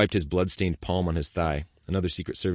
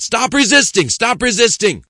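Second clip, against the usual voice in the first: very loud, much higher barked order; commanding yell; 14 dB louder than usual. Acted. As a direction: bellow it over and over. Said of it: "One of the officers yelled".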